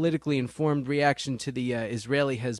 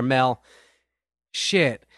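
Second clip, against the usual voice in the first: ordinary delivery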